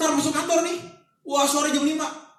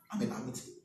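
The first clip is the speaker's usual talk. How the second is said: hushed aside; 4 semitones lower, 9 dB quieter than usual. Real, not acted. Muttered superstition.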